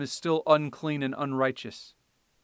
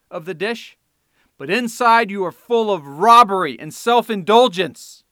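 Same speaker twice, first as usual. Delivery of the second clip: thundering woes; 4 semitones higher, 9 dB louder than usual. Acted. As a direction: cry out the woes at the hypocrites. Preacher voicing Jesus' woes.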